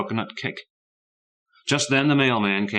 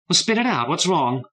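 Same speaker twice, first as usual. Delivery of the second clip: impatient wife; 10 semitones higher, 4 dB louder than usual. A narrator's reading.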